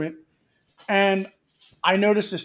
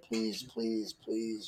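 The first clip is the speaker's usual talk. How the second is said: much lower, much softer pleading; mock begging; 9 semitones lower, 15 dB quieter, much slower than usual. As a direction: beg under your breath, hammed-up despair. Mock begging to end stupidity.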